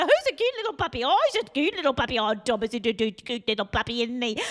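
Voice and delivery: high-pitched gushing puppy talk; exaggerated baby talk to a puppy. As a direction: coo over the dog to hide the swearing. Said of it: "My lo-, voice is a lot louder".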